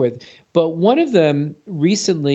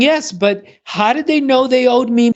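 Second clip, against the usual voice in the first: higher, insistent imitation of an elderly client; elderly client insisting; 9 semitones higher than usual. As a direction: mimic the old lady pressing her point, pitched up. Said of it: "And she's saying".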